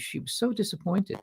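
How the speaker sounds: usual speaking voice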